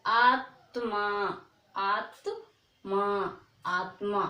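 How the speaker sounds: आत्मा stretched slowly, the half त said quickly before मा; आत्मा said slowly and repeated for pupils; slow teaching demo. Shown as a demonstration. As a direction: model आत्मा slowly, syllable by syllable. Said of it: "इसी प्रकार से".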